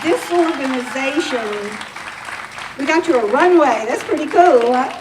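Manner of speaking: cheerful tease to the awardee; playful excitement. Real, not acted. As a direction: hype up the walk to the stage. Teasing the awardee about runway.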